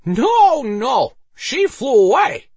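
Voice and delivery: put-on high, loud drunken cry; hilarious drunk. A narrator's reading. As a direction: whoop it out like a gleeful drunk. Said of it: "The words made Harold burst out into laughter".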